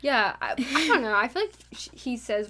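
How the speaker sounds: ordinary delivery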